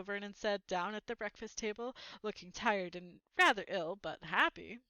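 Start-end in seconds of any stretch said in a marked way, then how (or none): none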